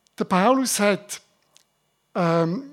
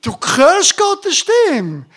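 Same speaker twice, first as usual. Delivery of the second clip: higher, louder, slow and weighty; emphatic insistence; 6 semitones higher, 6 dB louder, slower than usual. Acted. Preacher assuring doubters, loud and slow. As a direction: drive each word home.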